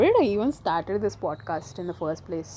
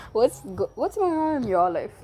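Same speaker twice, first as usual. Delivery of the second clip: normal talking voice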